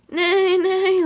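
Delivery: loud, high horse neigh; bad horse impression. Child reading a picture book, doing a loud horse neigh. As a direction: neigh like a horse, half-joking. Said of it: "I have such horrible animal noises".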